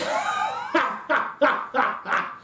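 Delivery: barking laugh. Acted. Cohn answering whether he's going to win, barking laugh. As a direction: derisive staccato bark of a laugh. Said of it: "Cohn laughs, this short, barking laugh".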